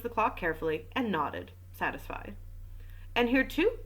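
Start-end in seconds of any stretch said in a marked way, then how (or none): 3.1-3.8 higher, mildly excited exclamation; lively narrated exclamation; exclaiming. A narrator's reading. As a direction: point out a find eagerly. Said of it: "exclaimed Bex".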